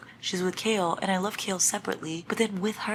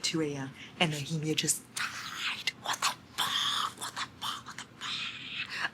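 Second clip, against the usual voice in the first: shocked whisper; 9 dB quieter than usual. Real, not acted. Reader at two a.m., whispering in shock. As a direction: breathe the words in disbelief. Late-night shock at death.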